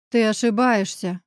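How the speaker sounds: usual speaking voice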